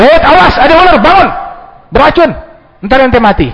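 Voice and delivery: high urgent yell of warning; alarmed warning shout. Acted. True friend yelling to warn about a snake, alarmed shout. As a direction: shout to wake a friend in danger.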